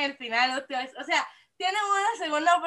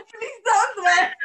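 laughing; 7 semitones higher, 6 dB louder, much slower than usual. Real, not acted. Laughing plea to fans.

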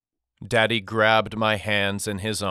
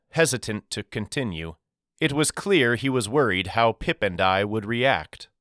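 usual speaking voice

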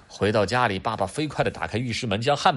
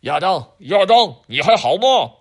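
loud, higher calling out; 8 semitones higher, 11 dB louder than usual. Acted. Dad calling down the drain to Adam, loud call. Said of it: "爸爸对着下水道喊".

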